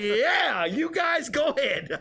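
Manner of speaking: high and slow, laughing; giving in with a laugh; laughing assent. Retold or imitated. Telling the couple to go ahead, laughing. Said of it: "I was like".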